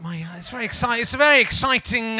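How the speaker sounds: normal talking voice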